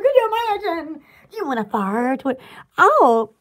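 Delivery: ordinary talk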